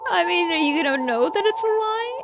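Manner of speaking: mock helpless housewife. Feigns innocence to the interrogator.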